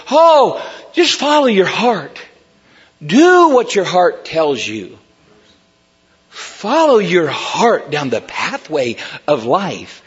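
hammed-up dreamy self-help tone; mocking the world. Preacher mocking worldly advice with a hint of sing-song. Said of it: "the world's opinion of the heart is".

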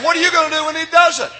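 high-pitched, quick pulpit cry; triumphant retort. Retold or imitated. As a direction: fling the comeback out for the crowd. Triumphant retort repeated to rouse crowd.

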